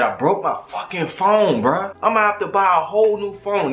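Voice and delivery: venting about the broken phone; retold angry rant.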